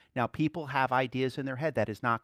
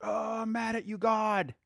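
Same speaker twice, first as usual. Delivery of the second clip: mock sulky complaint; 8 semitones higher than usual. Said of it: "that's kinda like".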